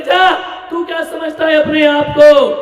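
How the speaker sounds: high, loud shouted challenge; angry shout. Retold or imitated. Wife shouts at husband during fight, angry shout. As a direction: yell it in a full quarrel. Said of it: "बोले".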